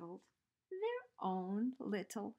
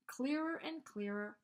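ordinary delivery, nothing marked

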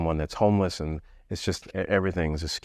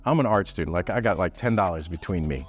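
ordinary talk

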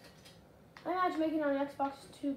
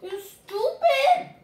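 a high, loud, drawn-out angry scream; frustrated scream; 11 semitones higher, 12 dB louder, much slower than usual. Real, not acted. Streamer screaming at the game over bad loot. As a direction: let the frustration explode, slowly.

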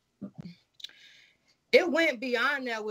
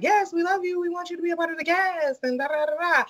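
mock gushing; 7 semitones higher than usual. Imitating the producer's call.

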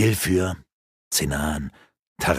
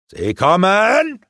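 higher, louder, slow drawn-out alarm; warning shout; 9 semitones higher, 5 dB louder, much slower than usual. Acted. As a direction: call out to warn the others. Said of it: "rief Faravin".

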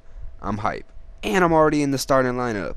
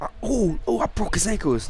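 excited; 8 semitones higher than usual. Real, not acted. Big play in game.

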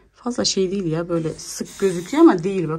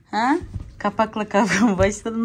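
normal talking voice

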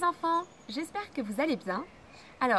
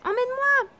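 high, loud pleading cry; frog's cry; 12 semitones higher, 8 dB louder than usual. Acted. Frog shouting after the princess, high and loud. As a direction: shout it after her, much higher and louder. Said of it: "criait la grenouille".

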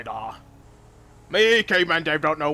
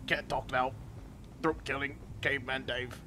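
usual speaking voice

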